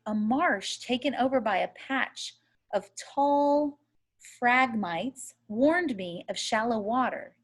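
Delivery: plain reading voice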